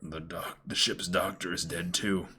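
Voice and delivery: very low, quiet and faint; faint dying voice. Acted. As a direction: barely get the words out. Reading dying crewman line.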